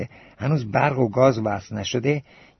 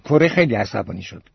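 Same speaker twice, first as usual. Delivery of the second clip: ordinary delivery, nothing marked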